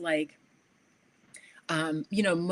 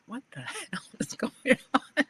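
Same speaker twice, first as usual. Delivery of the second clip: laughing disbelief; 6 semitones higher than usual. Retold or imitated. Retold bewilderment in the bathroom.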